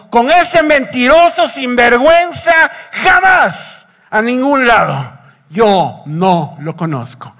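loud, high outburst falling to a slow, firm denial; acted indignant cursing. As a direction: curse him out, then spell out the denial word by word. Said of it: "Pedro blasfemó o maldijo".

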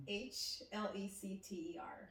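letters spelled out slowly and quietly; spelled out softly. Real, not acted. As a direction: spell each letter slowly, under your breath. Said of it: "Spell it out".

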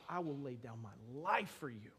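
a friend quietly vows his life, tender; soft sincere vow. Acted. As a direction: say it gently, heart open.

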